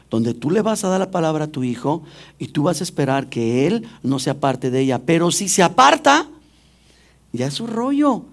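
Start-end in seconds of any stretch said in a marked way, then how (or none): none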